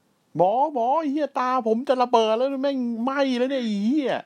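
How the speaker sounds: high, loud panicked whine to the doctor; mock panicked yell. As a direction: shriek like your eyes are burning. Dominik panicking at doctor backstage. (ตะโกนเสียงสูงแบบตื่นตระหนก)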